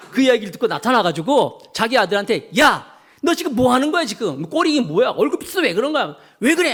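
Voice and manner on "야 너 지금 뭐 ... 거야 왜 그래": higher mock scolding of a grown son; a mother chewing out her trembling son. Queen mother scolding her son, mock angry scolding. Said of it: "야단을 막 치면서".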